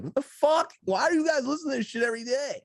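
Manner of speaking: high, loud incredulous protest; exasperated high-pitched questioning; appalled outburst. Retold or imitated. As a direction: burst out in disbelief, pitch way up. Acting out appalled reaction.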